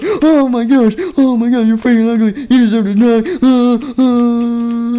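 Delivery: high, loud horrified shrieking; hammed-up piercing screams; screaming in pain. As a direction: shriek in over-the-top agony at an ugly face. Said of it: "screamed in pain".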